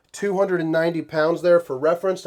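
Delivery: normal talking voice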